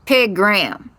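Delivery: mild bitter disgust; spitting the name. A narrator's reading. Stable manager sick of hearing the dead woman's name, mildly spat out. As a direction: spit it out like nails. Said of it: "spits the name like nails".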